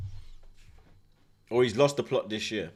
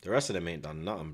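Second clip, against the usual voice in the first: normal talking voice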